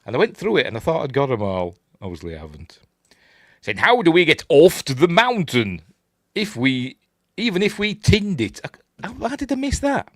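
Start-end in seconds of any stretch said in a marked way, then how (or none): 3.8-8.6 loud, high reading with "oft" and "tinned" as written; typos read aloud. Retold or imitated. Host reading faulty subtitles, "tinned" for "find", incredulous. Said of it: "mistakes like that on the blooming Blu-ray". 8.6-10.1 very high incredulous squeak; exasperated disbelief. Real, not acted. Host spotting a typo they missed, exasperated squeal.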